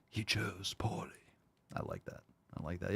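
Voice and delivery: whispered aside; whispering a movie reference. Real, not acted. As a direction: hush it like a secret.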